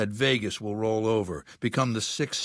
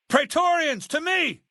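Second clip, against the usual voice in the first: much higher, loud summons across the room; commanding call; 5 dB louder than usual. Acted. Warlord summoning his Praetorian guards, commanding shout. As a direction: bellow for the guards to come.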